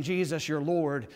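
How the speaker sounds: offhand, unemphatic mention; haphazard. Acted. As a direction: say it casually, without any weight. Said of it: "just kind of haphazardly saying".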